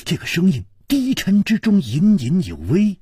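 ordinary delivery, nothing marked

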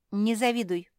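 high, loud retort; curt retort. A narrator's reading. Zefirka answers Kapitolina's scolding, retorting curtly. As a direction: cut the other off in a word. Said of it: "отрезала Зефирка".

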